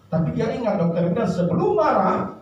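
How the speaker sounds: ordinary talk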